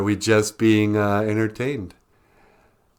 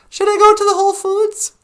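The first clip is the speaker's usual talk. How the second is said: very high, loud squeaky mock voice; hammed-up impersonation, pitched way up; Natasha; 7 dB louder than usual. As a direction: squeal it in an exaggerated falsetto imitation. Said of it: "I do a really good Natasha impersonation".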